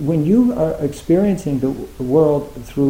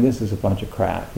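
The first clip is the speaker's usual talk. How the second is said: low, softer retold thought; inner grumble; 6 semitones lower, 5 dB quieter than usual. Sceptical meditators thinking on the cushion, quiet inner grumble. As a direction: say it under your voice, as private scepticism. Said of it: "you know, in their minds".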